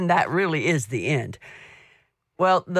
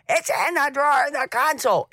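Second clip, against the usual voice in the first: repeating it a touch louder and higher; mock raised voice, calling up front; a bit louder; 10 semitones higher, faster than usual. Calling to her husband in the front of the motorhome, a little louder. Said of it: "I say it a little bit louder".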